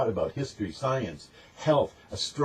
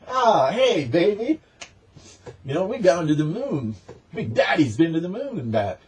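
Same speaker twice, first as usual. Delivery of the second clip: mock bragging swagger; 6 semitones higher than usual. Mocking boastful NASA voice.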